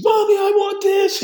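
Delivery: put-on high, loud kid whining for a treat; imitated child's excited yell; begging child. Child begging in a store, acted.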